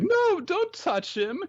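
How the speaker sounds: high-pitched mock protest; protective girls. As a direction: squeal it, guarding your idol. Girls protect Naputaku from Ren.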